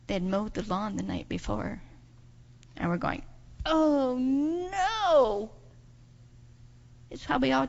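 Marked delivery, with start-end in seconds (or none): 3.7-5.5 drawn-out, high wail of dismay; dismayed groan. Acted. Searchers seeing the mowed lawn, drawn-out dismay. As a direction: let the words sink in a long mock wail.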